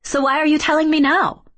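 high, insistent questioning; challenging question. A narrator's reading. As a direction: press for an answer, pitch lifted. Kay challenges the fairies.

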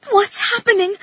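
high-pitched, alarmed cry; frightened cry. A narrator's reading. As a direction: cry out in sudden panic. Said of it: "I cried".

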